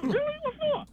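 very high, incredulous questions; retold disbelief, voice leaping high; mock surprise. Writer hearing Cosby wants him, surprised.

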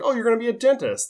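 high mock mother beaming at her son; mock cheery mom. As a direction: chirp it like a clueless proud mother. Voicing the oblivious mom.

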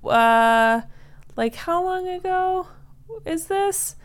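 high, puzzled questioning voice; high confused voice. Acted. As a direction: rise up, baffled. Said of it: "Ethel is like".

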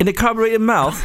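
high-pitched mock British accent. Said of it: "Can you do that in a British accent".

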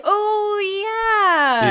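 surprised realization. Retold or imitated. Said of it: "someone's lines that were like".

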